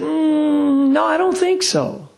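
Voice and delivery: high, drawn-out thinking hum into the answer; pondering reluctantly. Retold or imitated. Religious representative stumped by a question about animal souls, pondering. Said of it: "like he'd never even thought about it".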